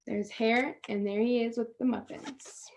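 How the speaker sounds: soft whispered aside; hushed aside pointing at the picture. Real, not acted. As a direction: breathe it to the class. Teacher aside while reading.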